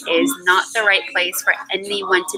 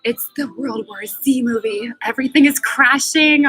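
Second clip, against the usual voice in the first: mock panic; 5 semitones higher than usual. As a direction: play a frantic movie-disaster panic. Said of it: "It's like".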